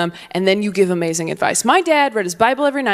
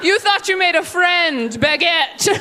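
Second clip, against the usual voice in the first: mocking baby; 8 semitones higher than usual. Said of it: "baby wheeled away".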